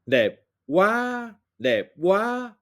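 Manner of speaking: "dep wa" with a high pitch, southern style; southern Vietnamese accent. Shown as a demonstration. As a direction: model "dep wa" the way the South says it. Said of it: "comme dans l'exemple".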